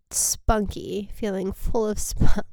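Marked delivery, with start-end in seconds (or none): none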